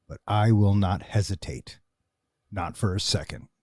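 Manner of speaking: low, quiet, menacing reply; calm, cold threat, softly spoken; movie scene reenactment. Retold or imitated. Answering in the diner scene.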